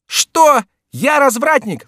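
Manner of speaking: very high, loud indignant squawk; scandalized high-pitched exclamation; outraged cry. A narrator's reading. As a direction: shoot up high in offended disbelief. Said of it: "вскричал Шико".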